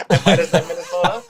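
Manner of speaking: amused at own mistake, laughing. Real, not acted. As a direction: laugh off the mix-up. Guest laughs at own slip.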